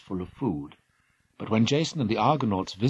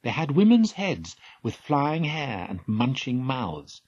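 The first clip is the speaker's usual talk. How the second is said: usual speaking voice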